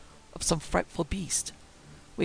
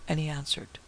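plain reading voice

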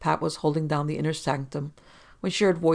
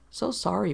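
higher lilting sing-song; 4 semitones higher than usual. A narrator's reading. Secretary brushing off the police, sing-song. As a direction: lilt it with mock apology. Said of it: "Pat sing-songed".

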